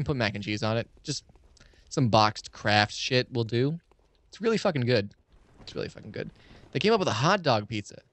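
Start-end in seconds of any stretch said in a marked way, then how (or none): 5.6-6.3 hushed, whispered aside; whispered repeat. Real, not acted. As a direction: repeat it under your breath for emphasis. Hushed repeat of the same praise.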